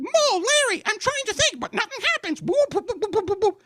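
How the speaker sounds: very high, squealing cartoonish clown voice; Three Stooges impression. Comedian recalls old slapstick show, very high clownish voice. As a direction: squeal it frantically, ending in silly boops. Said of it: "do you guys remember the Three Stooges".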